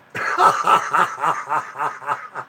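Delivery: long burst of staccato laughter; big laugh. Real, not acted. Cracking up after teasing the guys on the radio, laughing. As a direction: laugh long at your own joke. Laughs at his own taunt.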